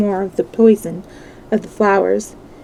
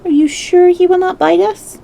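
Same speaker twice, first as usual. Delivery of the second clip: higher, small nervous question; timid; 8 semitones higher than usual. A narrator's reading. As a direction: ask shyly, half hiding. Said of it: "asked, in a timid voice".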